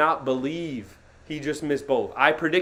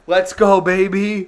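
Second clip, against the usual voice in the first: hype cheer; 5 semitones higher, 8 dB louder than usual. Real, not acted. Celebrating in the jersey.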